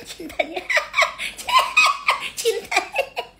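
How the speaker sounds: high mocking laughter through the word; squealing laugh. Real, not acted. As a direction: laugh shrilly while mocking. Mocking her love talk. (tertawa melengking sambil mengejek)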